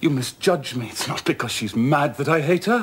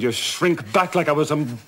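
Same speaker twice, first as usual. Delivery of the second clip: bitter outburst; 6 semitones higher, 6 dB louder than usual. Acted. Rochester's bitter reproach.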